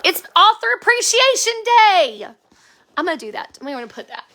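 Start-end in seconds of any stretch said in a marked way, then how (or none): 0.0-2.3 bright, loud, high proclaiming; cheery mock announcement of a made-up holiday. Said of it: "and be like".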